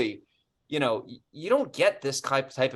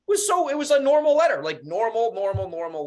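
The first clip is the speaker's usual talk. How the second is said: much higher and louder, insisting it was normal; exasperated insistence; 9 semitones higher, 5 dB louder than usual. Real, not acted. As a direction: protest in disbelief, hammering normal. Exasperated about his normal letter.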